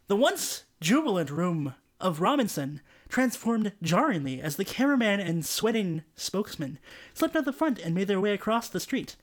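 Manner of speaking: plain reading voice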